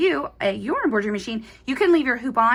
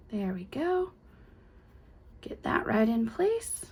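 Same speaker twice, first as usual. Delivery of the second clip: soft unvoiced whisper while placing; 6 dB quieter, slower than usual. Real, not acted. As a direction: whisper it as you line the piece up. Quiet placing of pieces.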